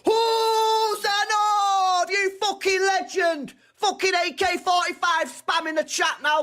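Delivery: high, drawn-out roar of praise; excited hype shout. Real, not acted. Streamer hailing a player to the chat, excited shouting. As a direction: cheer the player on at high pitch.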